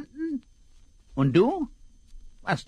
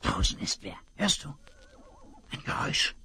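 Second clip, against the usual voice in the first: hushed, breathless warning whisper; urgent whisper; 5 semitones lower, 5 dB quieter, faster than usual. Acted. Whispering to his partner that someone is outside. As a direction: whisper so the eavesdropper won't hear.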